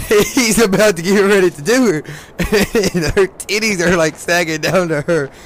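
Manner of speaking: can barely get it out for laughing; laughing while telling. Real, not acted. Laughing while telling crude story.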